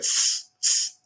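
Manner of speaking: "Tss, tss," as a sharp unvoiced hissing s; hissed plural s. Shown as a demonstration. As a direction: hiss "Tss, tss," with no voice, just the s. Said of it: "like I said".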